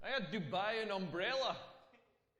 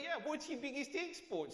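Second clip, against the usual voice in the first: put-on Australian accent; 7 semitones higher than usual. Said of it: "he's like".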